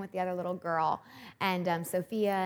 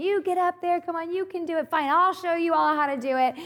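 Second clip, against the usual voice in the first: much higher, loud, taunting yell; drunk mom shouting; 11 semitones higher, 9 dB louder than usual. Retold or imitated. Drunk mom yelling at the husband to climb, loud taunting shout. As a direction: bellow the dare at everyone. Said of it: "starts saying".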